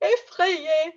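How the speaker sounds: high, loud mock-frightened cry; gasp, then cry it out as if afraid; hammed-up fright; acted scared. Acting out word for scared.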